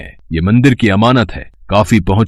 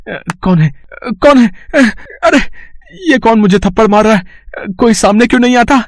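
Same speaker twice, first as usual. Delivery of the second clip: high, frantic cries of a man being slapped; panicked yelp; 12 semitones higher than usual. Acted. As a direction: shriek in fear at an invisible attacker. Thief slapped by unseen Hanuman.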